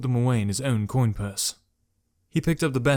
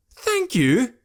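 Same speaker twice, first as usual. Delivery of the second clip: indignant shout; 14 semitones higher, 5 dB louder than usual. Acted. Jekrom shouts back at Malik in disbelief. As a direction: Thank shouted high and loud, rising. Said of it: "Now Jekrom was shouting".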